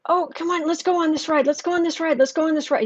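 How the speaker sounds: put-on high, eager child begging; a kid pleading to go on a ride; excited kid. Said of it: "He was so excited".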